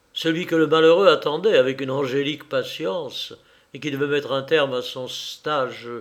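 ordinary talk, nothing marked

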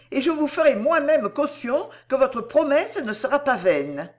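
high, fervent guarantee; earnest heroine, insistent. Acted. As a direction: pledge yourself, voice lifted high. Narrator voices Cécile guaranteeing.

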